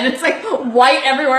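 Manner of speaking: ordinary talk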